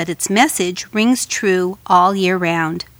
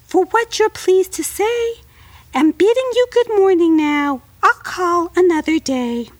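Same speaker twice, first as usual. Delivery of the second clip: very high, frightened little fly; high tiny fly voice; 9 semitones higher than usual. Acted. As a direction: squeak it like a scared tiny insect.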